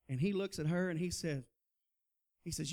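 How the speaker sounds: normal talking voice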